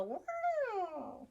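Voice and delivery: long drawn-out wow; tire screech wow. Shown as a demonstration. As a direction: stretch it like tires squealing. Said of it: "the tires go".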